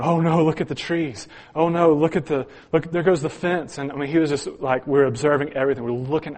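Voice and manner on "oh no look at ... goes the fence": higher, louder panicky cry of oh no; imitated panic. Brother watching the tornado from the basement, mock panicked cry. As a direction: play the scared brother, a touch hammed up. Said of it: "And he's going".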